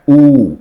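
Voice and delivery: ऊ pushed out loud and heavy; forceful heavy oo. Shown as a demonstration. Teacher stressing the heavy ऊ sound loudly. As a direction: put force behind the ऊ. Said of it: "तो जोर लग रहा है".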